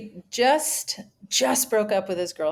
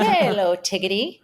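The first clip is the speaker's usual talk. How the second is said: mock Irish accent. Old roommate greets his housemate playfully, mock Irish accent. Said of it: "we used to always talk to each other like".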